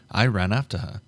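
ordinary delivery, nothing marked

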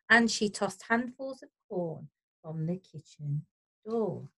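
usual speaking voice

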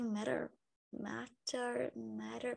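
matter com t soando como r; American flapped t. Shown as a demonstration. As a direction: matter dito mérer, t batido. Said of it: "Vira som de er aqui". (t de matter vira r)

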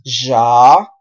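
"Ya" as a long, loud zhah; sound drill. Shown as a demonstration. Teacher trains the Portuguese J sound, drill voice. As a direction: hold "Ya" with buzzing zh. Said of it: "vamos a entrenar este sonido".

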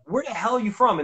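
imitating your own surprised question to a stranger; acting out a baffled outburst; mock astonishment. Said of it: "and I'm like".

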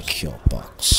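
whisper. Acted. Whispered game show line.

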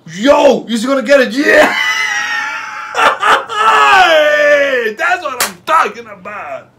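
loud high victory yell; excited celebrating. Real, not acted. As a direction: celebrate the point wildly. Point won, celebrating.

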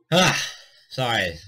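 ordinary talk

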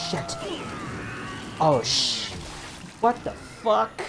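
loud, high, drawn-out disbelief; real startled shout, stretched out; shocked cursing. Real, not acted. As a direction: yell out in sudden alarm. Sudden setback in game.

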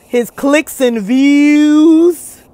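very slow, last word held long; exaggerated slow-motion stretch; mock slow talking. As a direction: drag it out, imitating a slow talker. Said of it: "because if he talks slow".

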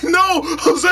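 high panicked yelp, hammed up; playful fake-scared shriek; mock panicked cry. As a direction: cry out like you just woke up terrified. Acting out Kane waking in panic.

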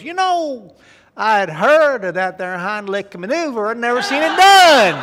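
high, loud country drawl, laughing through the punchline; country boy impressed, drawled high and loud. Acted. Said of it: "in Kentucky, you know, the rhythms are kinda slow".

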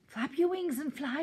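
high, loud urgent shout; yelling. A narrator's reading. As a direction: yell a desperate instruction. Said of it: "yelled Jake".

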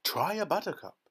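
high, lively call; eager cry. A narrator's reading. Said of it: "cried Mister Wonka".

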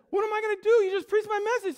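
high, loud, frantic protest; acted panic. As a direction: cry out in comic desperation. Old preacher panics, robbed of message.

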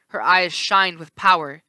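plain reading voice

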